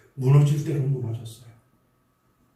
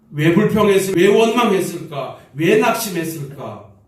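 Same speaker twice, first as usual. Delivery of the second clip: louder, impassioned pleading in prayer; fervent prayer; 6 dB louder than usual. Real, not acted. Pastor crying out in prayer for his flock, loud and impassioned. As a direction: cry the questions out to God.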